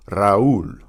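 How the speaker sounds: Raúl as two syllables, ra-OOL; Spanish Raúl, trilled R and accented ú; separate syllables. Shown as a demonstration. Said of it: "it's a separate syllable".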